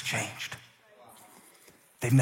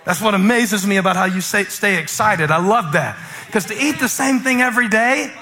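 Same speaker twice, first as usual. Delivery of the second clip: loud, higher, punchy sermon delivery; full-force preaching; 11 semitones higher, 5 dB louder than usual. Real, not acted. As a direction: belt it out to the congregation.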